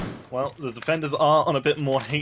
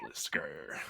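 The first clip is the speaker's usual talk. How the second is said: imitating Lonnie's grr; 10 semitones lower, 15 dB quieter than usual. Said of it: "I can't do the grr like Lonnie can".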